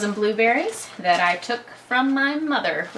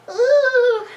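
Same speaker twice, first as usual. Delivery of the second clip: delighted ooh; 8 dB louder than usual. Real, not acted. Excited cooing at mixing.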